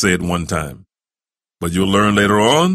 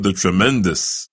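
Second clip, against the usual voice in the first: ordinary delivery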